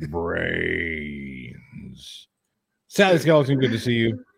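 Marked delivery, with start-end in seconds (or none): 0.1-1.5 long, low zombie moan; drawn-out, softer undead groan. Pretend. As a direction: stretch the word out like a mock zombie. Reads viewer's zombie greeting.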